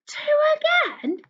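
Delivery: gasp, then a high, drawn-out question; mock amazement. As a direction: play up the surprise for little ones. Mock surprise for young learners.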